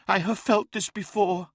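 high-pitched, haunted confession; reliving painful memory. A narrator's reading. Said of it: "reliving a painful memory".

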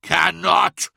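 loud, high, indignant roar; incensed, explosive question; thundering outrage. A narrator's reading. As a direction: throw the word back as a booming challenge. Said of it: "thundered Magnus".

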